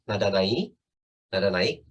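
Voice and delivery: NAIK lifted at the end each time; low start, "naik" climbing like a question; rising tone. Shown as a demonstration. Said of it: "Nah, kalau nada naik".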